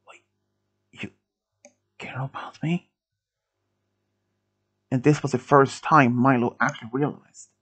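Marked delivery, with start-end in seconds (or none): 0.1-2.9 faint, halting whisper; weak whispered question. A narrator's reading. Wounded Andy questioning Milo, faint whisper. Wounded boy hears confession.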